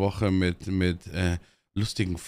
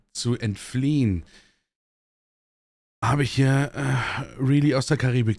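dramatic intro; slower than usual. Acted. Slow poetic show intro.

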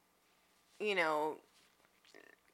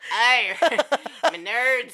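very slow, louder Yorkshire "me nerds"; mock Yorkshire accent; 5 semitones higher, 7 dB louder, much slower than usual. Co-host trying a Yorkshire accent, slow and laughing. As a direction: stretch it out in a Yorkshire drawl. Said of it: "it's like Yorkshire accent".

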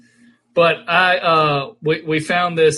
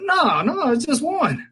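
high retold reply; 10 semitones higher, slower than usual. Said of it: "I said".

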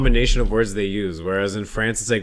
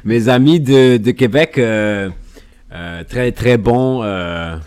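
a hint of an English-accented French, louder; halting French; 2 semitones higher, 6 dB louder, slower than usual. Host greeting Quebec fans in halting French, louder. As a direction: stumble through a French greeting. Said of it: "our French accents".

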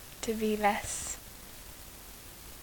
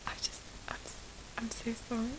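hushed apology; 10 dB quieter, much faster than usual. Retold or imitated. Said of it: "And you're like".

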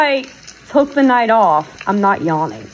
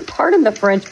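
ordinary delivery

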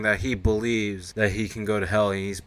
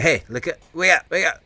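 loud, high mock wake-up call; urgent rousing; 7 semitones higher, 6 dB louder than usual. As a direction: play a puppy urgently rousing its owner. Voices puppy rousing unconscious owner.

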